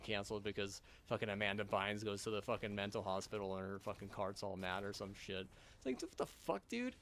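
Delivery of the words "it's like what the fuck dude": exasperated squeal. Real, not acted. Exasperated at being canceled.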